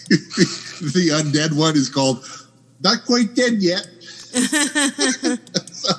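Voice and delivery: giggling while talking; laughing. Real, not acted. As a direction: laugh through the words. Laughing through a funny title.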